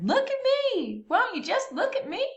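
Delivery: high, bright whoop of a cowgirl showing off; whooping and hollering. A narrator's reading. Cowgirl showing off her new red boots, high whooping call. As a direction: holler it out, delighted with herself. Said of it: "she whooped and hollered".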